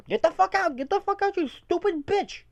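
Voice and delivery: high-pitched, strained tirade, slowing on the insults; retold rant without full volume; mock furious boss. Boss going on at worker talking back, mock fury. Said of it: "he's like".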